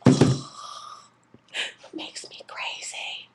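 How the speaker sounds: hissed, exasperated whisper; frustrated whisper. Retold or imitated. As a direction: whisper it through clenched frustration. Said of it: "And I'm like".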